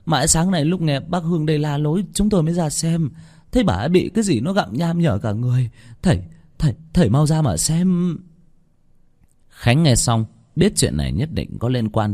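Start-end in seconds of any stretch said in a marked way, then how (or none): 0.1-8.2 higher-pitched, worried report; mildly agitated, stammering near the end; anxious villager. A narrator's reading. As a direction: plead with the master to come. Frightened villager reports the death.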